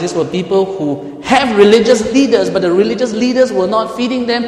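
rising preacher shout; full-force sermon climax; preaching loudly. Acted. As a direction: drive it home to the congregation.

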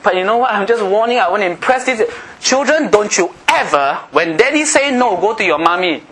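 high-pitched, forceful sermon delivery; impassioned preaching. Real, not acted. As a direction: preach it hard, pitched well up.

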